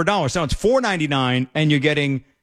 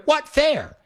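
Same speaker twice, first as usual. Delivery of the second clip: scoffing retort; 9 semitones higher than usual. Acted. Scoffing at the complainers.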